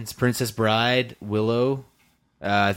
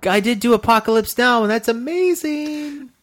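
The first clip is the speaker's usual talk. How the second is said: mock enthusiasm; 13 semitones higher, 5 dB louder than usual. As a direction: stretch it out in a high, squeaky voice. Mock-enthusiastic defence of a filmography.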